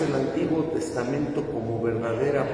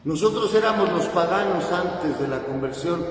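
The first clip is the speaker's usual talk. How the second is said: loud, high, driving sermon voice; fervent preaching; 6 semitones higher, 5 dB louder than usual. Acted. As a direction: preach it at full force to the congregation. Priest preaching with rising force.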